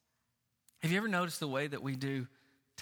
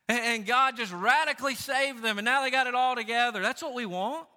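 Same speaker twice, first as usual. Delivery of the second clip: scoffing, much higher on the shrugging questions; mocking dismissal; 6 semitones higher, 5 dB louder than usual. Churchgoers scoffing at a confessed sin, mock dismissive. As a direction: brush off a small sin with mock disdain. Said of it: "somebody started talking like that".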